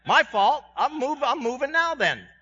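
mock hurried God apologizing; God voiced. God answers the impatient believer, mock hasty apology. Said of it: "And God says".

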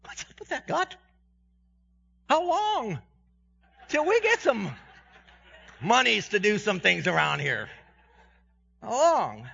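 high pleading complaint; complaining to God. Retold or imitated. Pastor in car complains to God, high pleading whine. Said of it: "thinking in my head in the car".

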